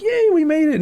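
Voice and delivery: high, happy squeal of a cheer; excited cheer. Retold or imitated. Vlogger cheering to the fans behind him, high and excited. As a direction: celebrate like you just got in.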